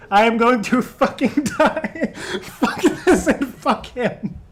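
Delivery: real laughing rage at a friend; laughing mock fury. Real, not acted. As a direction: hate him, but you're cracking up. Rival got the clue.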